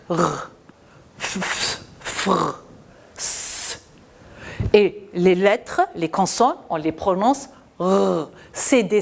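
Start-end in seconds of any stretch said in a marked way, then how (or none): 0.1-3.8 whispered f, f and s, breathy fr; isolated consonant sounds. Shown as a demonstration. Teacher showing consonant sounds to children. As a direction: hiss the bare consonant sounds. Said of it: "on lit".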